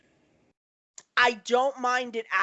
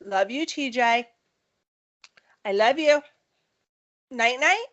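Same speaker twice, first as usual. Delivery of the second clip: motherly goodnight; 2 semitones higher than usual. Real, not acted. Mother saying night-night to her son, affectionate. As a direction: say it tenderly to your little one.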